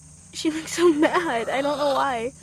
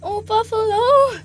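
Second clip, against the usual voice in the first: surprised exclaim; 6 semitones higher, 6 dB louder, slower than usual. Real, not acted. Exclaiming at hen.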